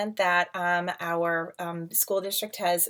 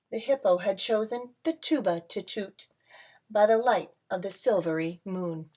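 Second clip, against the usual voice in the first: lilting read-aloud storybook voice; rhyming picture book read to a class; reading to children; 3 semitones higher, 5 dB louder than usual. A narrator's reading.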